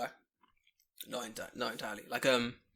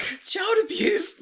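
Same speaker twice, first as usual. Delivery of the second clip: high, loud, slow mock protest; joking that homework is abuse, hammed-up; mock outrage; 9 dB louder, much slower than usual. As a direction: overplay the drama. Mock outrage at reading punishment.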